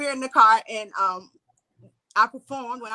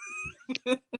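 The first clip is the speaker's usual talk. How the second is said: high laugh; 9 dB quieter than usual. Real, not acted. Laughing at own story.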